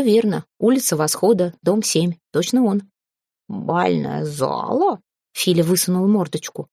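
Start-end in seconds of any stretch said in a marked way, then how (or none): 3.5-5.0 drawn-out, slow astonished question; astonished cat. A narrator's reading. Cat pipes up at talk of a ballroom, slow astonishment. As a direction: stretch it out in a cat's character voice. Cat pokes head out, asks.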